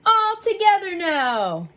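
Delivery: loud, high team call; building shout to heave together; rallying count. Acted. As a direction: count everyone in for a big push.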